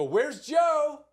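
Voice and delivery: high, loud singsong call. Retold or imitated. Babysitter calling the boy to play, high singsong calling. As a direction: imitate a babysitter's drawn-out, playful call. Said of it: "she'd call me".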